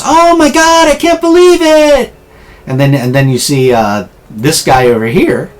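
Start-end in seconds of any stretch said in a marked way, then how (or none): 0.0-2.1 starstruck fan squeal. Acted. Bystander spots Dazzler in the street, starstruck squeal. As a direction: squeal like you just spotted your idol. Same fan keeps gushing.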